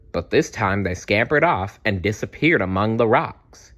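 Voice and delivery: ordinary delivery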